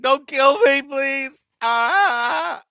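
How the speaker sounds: high, loud, terrified cry of a cornered hostage; panicked pleading. Pretend. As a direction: shriek it out like you've just been caught. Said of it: "you nailed that scene".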